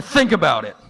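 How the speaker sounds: high, loud and slow, savoring each word; triumphant reveal. Retold or imitated. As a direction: lay out the big revelation. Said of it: "But then he said".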